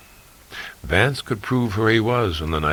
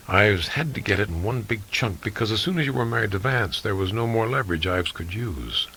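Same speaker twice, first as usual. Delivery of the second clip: usual speaking voice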